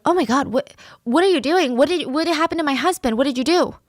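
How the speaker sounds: high, frantic questioning; acted panic. A narrator's reading. Elderly wife confronting the attacker about her husband, panicked.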